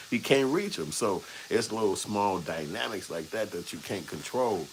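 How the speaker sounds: ordinary talk, nothing marked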